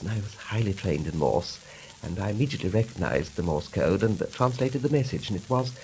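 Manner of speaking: usual speaking voice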